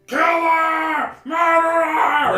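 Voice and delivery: screamed accusation, very high and loud; bugbear alarm cry. Acted. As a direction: roar each word out long, raising the alarm. Other bugbear raises the alarm.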